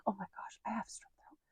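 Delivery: hushed, voiceless realization; dismayed whisper. Retold or imitated. Mom realizing she caught strep, dismayed whisper. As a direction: breathe it out in dread.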